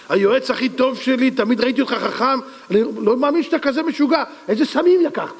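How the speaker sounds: high, climbing outraged disbelief; incredulous scolding. Acted. King telling best advisor he is crazy, incredulous.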